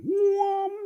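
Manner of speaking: very high-pitched humming beam sound; playful sci-fi ray noise, held high; imitated beam noise. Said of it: "Awareness beam".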